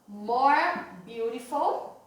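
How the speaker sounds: slow model phrase. Shown as a demonstration. Teacher shows students the form, slow and clear. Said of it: "eu falo".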